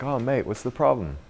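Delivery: lightly voiced, high and easygoing Australian mate; breezy, unbothered greeting like a kind old Aussie; casual Aussie Eddie. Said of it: "really casually".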